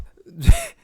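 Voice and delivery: loud high laugh. Real, not acted. Laughing at the memory.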